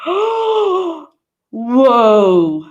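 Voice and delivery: mock shock. Learner shocked at movie grammar, mock exclaim. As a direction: play the scandalised learner. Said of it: "you will be like".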